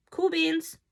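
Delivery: high, goofy cool beans; stoned kid thanks teacher, high and goofy; goofy stoned reply. Retold or imitated. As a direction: toss it off like a dazed stoner.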